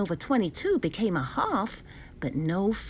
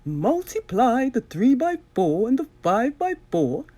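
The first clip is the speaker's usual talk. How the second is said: slightly higher, louder, prim teacherly lady; a lady mathematician explaining, a touch higher; Madame's voice; 4 semitones higher, 4 dB louder than usual. Acted. Madame explains the fractions, lightly voiced lady.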